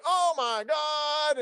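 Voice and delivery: slow high mock shriek; slow, high imitation of a shocked mother; imitating mom. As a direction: stretch it out high and shrill like mom. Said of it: "she was like".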